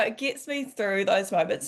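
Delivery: ordinary talk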